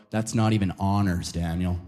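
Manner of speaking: low mock-disapproving voice; unimpressed friends. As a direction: imitate a friend's put-down, pitched well down. Friends looking down on his answer.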